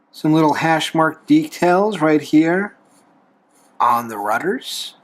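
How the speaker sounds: ordinary talk, nothing marked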